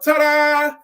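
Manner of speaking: high, very loud showman ta-da; ringmaster ta-da. Retold or imitated. Preacher acting out the acrobats for the congregation, big showy ta-da. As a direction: belt it out like a circus performer. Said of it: "throws her arms up".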